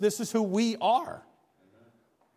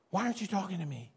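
much softer, quicker, almost breathed-out plea to God; hushed plea; 8 dB quieter, faster than usual. Shown as a demonstration. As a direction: drop to a private murmur as if praying alone. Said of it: "repeated plea contrasted".

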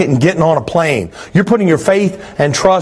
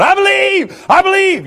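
put-on much higher squeaky baby voice chanting; mock newborn; 12 semitones higher than usual. Preacher mocks the claim of always believing, high baby voice. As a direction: play a newborn proudly announcing faith. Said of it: "pop out of your mama going".

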